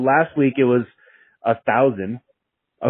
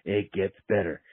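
soft reassurance; 5 semitones lower, 9 dB quieter than usual. Retold or imitated. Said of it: "and he goes".

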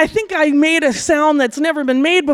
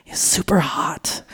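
whispered, lower and softer; breathy whisper; 7 semitones lower, 5 dB quieter, slower than usual. Retold or imitated. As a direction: breathe it like a secret thought. Private thought about his competence.

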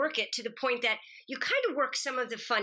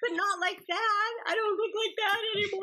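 high, faster mock cry; mock whining; 11 semitones higher than usual. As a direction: whine it like a fake sob. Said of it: "I'm like".

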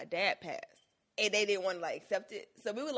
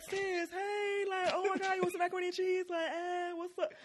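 Twan voice; 12 semitones higher than usual. Pretend. Couple playing Twan for each other, high and camp. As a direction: gush like a flamboyant friend. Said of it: "we have a voice we do. His name is Twan".

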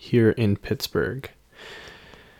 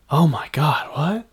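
mock shock; 4 semitones higher than usual. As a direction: pretend astonishment, rising on "what". Acting out shocked reaction to alien news.

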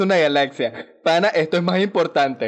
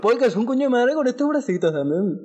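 high, thug-like complaining dinosaur; T-Rex voice; 7 semitones higher than usual. Pretend. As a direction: whine up high like a street-tough T-Rex. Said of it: "esos hayan sido así, super malandros venezolanos".

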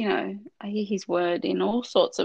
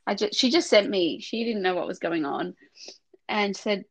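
ordinary talk

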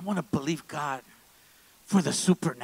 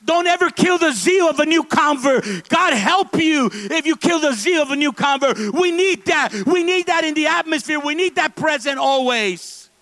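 loud, much higher preaching warning; full-force preaching; 12 semitones higher, 10 dB louder than usual. Real, not acted. Pastor warning the congregation at full preaching force. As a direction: thunder the warning at the crowd.

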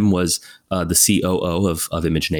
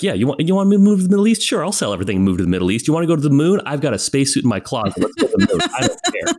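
mock eager; 7 semitones higher, faster than usual. Mock eager yes to anything.

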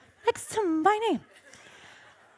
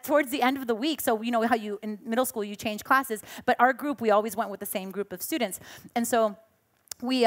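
ordinary talk

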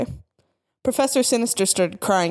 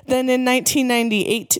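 usual speaking voice

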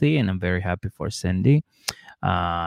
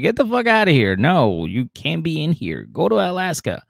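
high, loud mock shouting; angry neighbours; 7 semitones higher, 4 dB louder than usual. Neighbours throwing Cindy out, mock angry shouting. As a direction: chase her off in a raised mock yell. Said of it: "And everybody was like".

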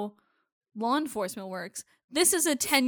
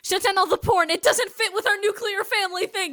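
mock pleading; 8 semitones higher, 4 dB louder than usual. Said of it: "mocking Gideon's porn order".

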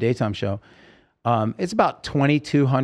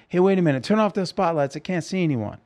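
higher-pitched call to the crew; retold call into blinding spotlights, pitched up; calling out from stage; 6 semitones higher, faster than usual. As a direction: halt the show from the stage.

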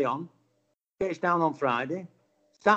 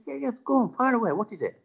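higher, lightly voiced well-spoken man; 9 semitones higher than usual. Older angler invites questions, lightly well-spoken. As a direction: imitate obliging, well-spoken gentleman. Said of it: "and very well spoken".

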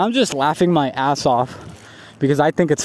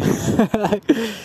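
short, higher-pitched giggle; brief amused cackle; real laugh; 6 semitones higher than usual. Real, not acted. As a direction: burst out laughing at your own story. Cracks up at own story.

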